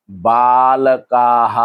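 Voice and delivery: बालकाः very slowly, "baa-la-kaa-haa"; long-vowel plural. Shown as a demonstration. Teacher giving the plural form बालकाः, long drawn-out vowels. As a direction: hold both long vowels closing बालकाः. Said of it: "का पे डंडा आ गया और हा".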